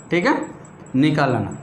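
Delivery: normal talking voice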